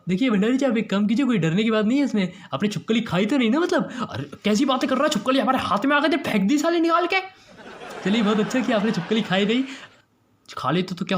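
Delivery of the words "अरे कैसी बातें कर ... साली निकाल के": high, quick indignant retort; irritated denial. Acted. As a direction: protest with rising pitch. (चिढ़कर ऊँची आवाज़ में)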